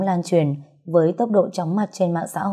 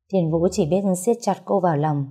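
plain reading voice

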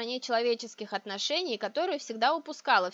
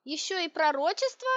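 higher, rising disbelieving question; incredulous; 6 semitones higher than usual. A narrator's reading. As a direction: question it as one absurdity too many. Heroine reacts in disbelief.

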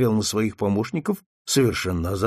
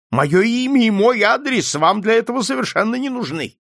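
high, loud, agitated protest; outraged cry; 9 semitones higher, 6 dB louder than usual. A narrator's reading. Old man refuses detective his name and address, crying out. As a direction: cry out in alarm and suspicion. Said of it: "Старик так и закричал".

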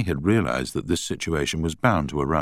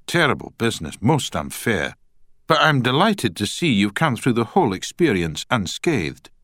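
put-on Scottish accent; 6 semitones higher than usual. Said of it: "in a strong Scottish accent".

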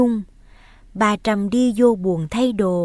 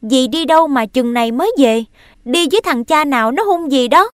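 put-on a boy shouts his accusation, pitched up and loud; loud demanding question; 4 semitones higher, 5 dB louder, faster than usual. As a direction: raise the voice, demand an answer. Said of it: "hỏi lớn rằng".

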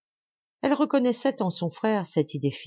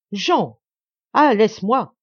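loud, higher-pitched outburst; urgent exclamation; 4 semitones higher, 8 dB louder, slower than usual. A narrator's reading. Brother tears free of his sister, urgent loud cry.

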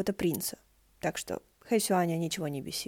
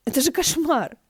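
horrified; 8 semitones higher, 7 dB louder than usual. Acted. Horrified exclamation.

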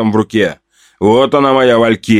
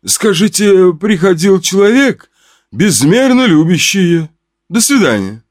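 higher, wistful rejected suitor; sad reply; 7 semitones higher than usual. Acted. Suitor sends love to the woman, sadly. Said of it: "грустно ответил".

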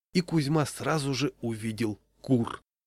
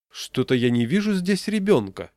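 mock surprise, higher and louder; feigned puzzlement; 5 semitones higher, 5 dB louder than usual. A narrator's reading. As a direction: pretend you can't see who's missing. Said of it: "притворно сказал Яшкин". (притворное недоумение)

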